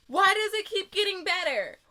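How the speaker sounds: high, loud thrilled question; loud high giddy wonder; excited disbelief. Real, not acted. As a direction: exclaim it overjoyed. Overwhelmed joy.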